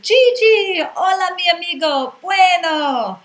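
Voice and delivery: loud, high festive cheers of welcome; cheering chorus. Retold or imitated. The bar crowd greeting their regular Gigi, loud festive cheers. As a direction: hail him like the whole bar is celebrating. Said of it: "fills with choruses of".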